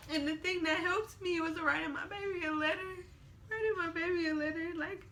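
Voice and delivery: high, tearful voice; crying. Real, not acted. Mother recalling the letter to her baby, crying. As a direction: talk on through real tears. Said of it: "Why are you crying?".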